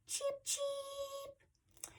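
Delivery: very high, soft chick peeps; little chick cheeping. Acted. Reader shows children a chick's hello, high soft cheeps. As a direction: squeak it out like a baby chick. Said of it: "How do little chicks say hello?".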